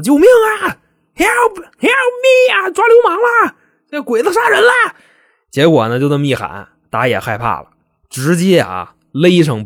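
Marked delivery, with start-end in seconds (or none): 0.0-4.7 very high, loud acted screams for help; screaming for help. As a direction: shriek for rescue, stretching out the cries. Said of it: "就在屋里喊上了".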